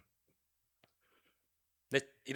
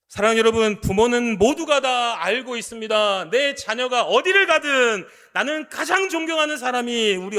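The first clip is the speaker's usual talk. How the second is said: full-force preaching; 8 semitones higher, 9 dB louder than usual. Acted. Pastor urging the congregation, loud and high. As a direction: drive the point home from the pulpit.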